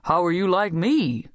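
taken-aback colonel, voice lifting a little; astonished. A narrator's reading. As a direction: ask it in mild disbelief. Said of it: "fairly gasped the colonel".

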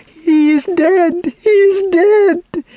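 high, drawn-out anguished wail; girl's piercing cry of grief; wail of distress. A narrator's reading. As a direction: wail it loud and slow in despair. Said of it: "with a wail of distress".

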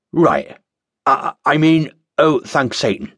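stammering, caught-out correction; flustered cultist. Acted. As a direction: fumble the correction nervously. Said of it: "said the admonished cultist".

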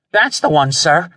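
high, nervous subordinate; stammered. A narrator's reading. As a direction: point it out high and flustered. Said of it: "the man stammered".

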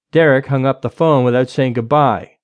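usual speaking voice

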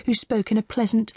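plain reading voice

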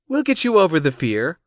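high-voiced coaxing; coax, lifting the name at the end; high, persuasive appeal. A narrator's reading. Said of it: "coaxed Cassie".

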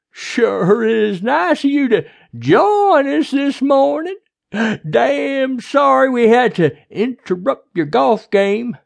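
loud, high, slow drawl; overbearing sheriff, sarcastic. A narrator's reading. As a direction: needle the doctor with mock politeness.

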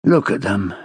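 low, weary remark. A narrator's reading. Boyce to a colleague about the onlookers, wearily. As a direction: sound mildly worn down, low in pitch. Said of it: "Boyce said wearily".

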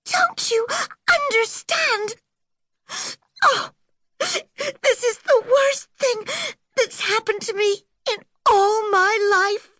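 put-on girl weeping over the worst day of her life; crying. A narrator's reading. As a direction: sob through the despair. Orphan girl still crying.

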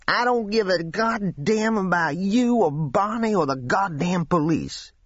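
high, furious outburst; angry young man shouting at Williams before he leaves. A narrator's reading. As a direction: hurl it at him before storming out. Angry boy storming off.

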